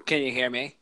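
choppy robotic connection; glitchy robot voice over a bad line. Real, not acted. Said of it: "you sound like a robot".

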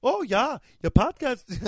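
high-pitched, badly faked Belgian accent. Host mocking a European listener, bad Belgian accent. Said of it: "I can't even do that fucking accent".

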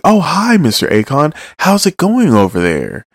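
high, sing-song cheerful greeting; typical Urahara fashion. Retold or imitated. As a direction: chirp it like an unbothered trickster. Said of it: "in his typical, uh, Urahara fashion".

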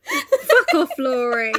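high, loud, amused indignation; mock outrage. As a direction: burst out, laughing on the name. Outrage at the mocking gift.

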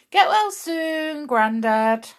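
warm wish. Real, not acted. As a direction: say it tenderly to a sick grandad. Wishing her sick granddad well.

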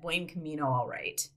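muttered under the breath, trailing to a whisper; grudging inner reply, barely voiced; sarcastic thought, whispered low. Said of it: "I'm thinking in my head".

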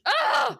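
loud, high groan of disgust; disgusted ugh. Real, not acted. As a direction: let out a sharp fed-up ugh. Disgust at Clay's behaviour.

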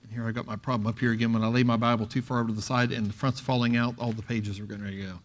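low, quick, soft aside; muttered aside. Real, not acted. Preacher fussing with his loose Bible pages, real muttered aside. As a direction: mumble it quickly to yourself, fading away.

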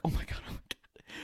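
hushed, voiceless mock panic; soft, smothered disbelief; stifled whisper. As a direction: whisper it while trying not to laugh. Stifling laughter under the covers.

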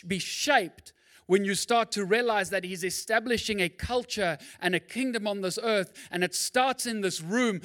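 loud, driving sermon build; full-force preaching. Acted. Pastor preaching at full force to the congregation.

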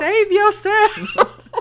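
high, drawn-out mock plea; hammed-up warning cry. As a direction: wail a warning through laughter. Calling out warning to movie heroine.

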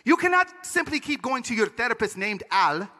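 put-on firm advice, Panamanian accent; Panamanian father. Dad counselling his son about Al, accented admonition. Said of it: "He said".

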